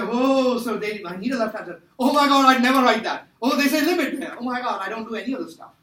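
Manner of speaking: panicked student. Acted. Teacher acts out students seeing the exam rubric, mock panic. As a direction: exclaim like a student in mock horror. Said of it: "You'll say".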